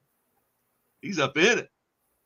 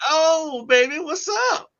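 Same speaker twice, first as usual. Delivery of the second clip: much higher, teasing; mock smooth swagger; 13 semitones higher, slower than usual. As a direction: tease like a winner. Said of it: "then I'll be like".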